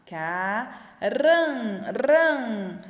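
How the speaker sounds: "Caran, ran" with a long, loud "ran"; sounding out syllables. Shown as a demonstration. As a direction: stretch the nasal "ran" on its own. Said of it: "pra dar esse sonzinho".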